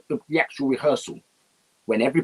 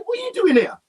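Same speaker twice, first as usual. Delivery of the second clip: surprised. Retold or imitated. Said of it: "Bob up and said".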